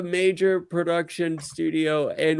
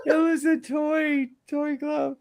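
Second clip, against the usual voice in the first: mocking; 13 semitones higher than usual. Real, not acted. Mocking the toy glove.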